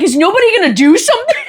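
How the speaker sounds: high-pitched mock distress; distraught. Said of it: "and I'm distraught".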